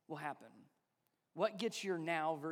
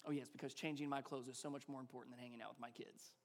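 self-mocking sarcasm; 7 semitones lower, 12 dB quieter, faster than usual. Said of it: "That's what I say in the moment".

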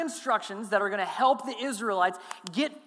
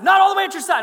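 very loud, much higher yell; drill sergeant yell; 10 semitones higher, 12 dB louder, faster than usual. Retold or imitated. Drill sergeant screaming at the recruit, loud. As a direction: scream the correction. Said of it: "treat me like you're a drill sergeant".

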